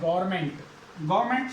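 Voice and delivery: गवर्नमेंट as two syllables, "gor-ment"; mistaken "gorment". Shown as a demonstration. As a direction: swallow the "van" in गवर्नमेंट: "gorment". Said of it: "गवर्नमेंट सही नहीं है वो".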